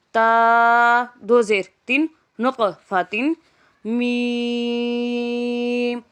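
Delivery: slow chant, letters drawn out then joined; Qaida spelling drill. Shown as a demonstration. Teacher chanting letters for pupils, drawn-out sing-song spelling. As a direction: spell it out for a child learning Quran letters.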